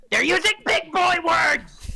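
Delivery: high and loud comic complaint; host blaming the script, comic outburst; mock exasperated shout. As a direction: throw up your hands and yell it. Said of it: "I'm gonna need to fire my scriptwriter".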